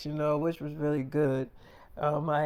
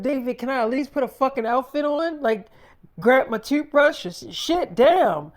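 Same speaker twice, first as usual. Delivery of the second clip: frantic pleading; 7 semitones higher, 3 dB louder than usual. Retold or imitated. Wife pleads to grab outfit.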